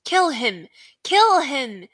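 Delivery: loud repeated yelled command; villain yelling for the kill. Acted. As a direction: bark it again and again. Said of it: "Voldemort's yell of".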